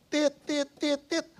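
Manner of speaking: high, loud beeping horn imitation; honking car horn. Preacher honking at the blocking angkot, loud mock horn. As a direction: tap out the horn like a frustrated driver.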